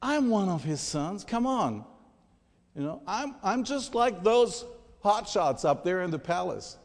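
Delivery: mild indignation, voicing a resentful son; indignant underdog. Preacher voices Abimelech's envy of the palace sons, indignant. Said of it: "I can see him thinking".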